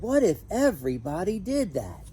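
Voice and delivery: lightly voiced zookeeper scolding. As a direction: wag a finger at the child like a zookeeper. Zookeeper scolds while waving broom.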